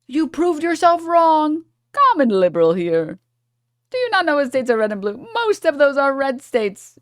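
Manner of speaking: high-pitched, put-on commenter ranting; hammed-up high voice ridiculing the commenter; mocking chatter voice. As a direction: parody an indignant online arguer up high.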